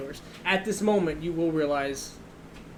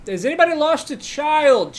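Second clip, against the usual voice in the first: raised, high, loud call to bystanders; 10 semitones higher, 10 dB louder than usual. Acted. Guard asking the crowd about a stray child, calling out. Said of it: "and he will say".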